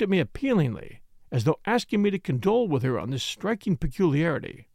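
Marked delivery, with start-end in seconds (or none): none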